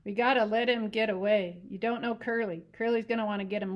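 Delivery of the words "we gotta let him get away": ordinary delivery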